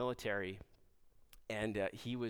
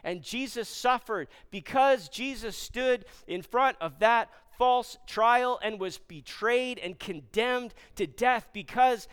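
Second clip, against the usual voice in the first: loud, high, slow emphatic delivery; impassioned preaching; 8 semitones higher, 7 dB louder, slower than usual. Real, not acted. Pastor proclaims hope and forgiveness to congregation, slow and forceful. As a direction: preach each phrase with force and weight.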